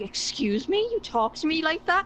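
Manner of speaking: imitating someone taking offence, pitch jumping on the question; mock offended. Other nationalities reacting to Irish banter, mock offended. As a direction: act affronted, rising into a challenge. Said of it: "they get really offended".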